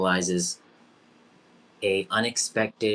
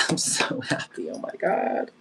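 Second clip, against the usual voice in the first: whispered joy; 6 semitones higher than usual. Real, not acted. Host overwhelmed, whispers joy.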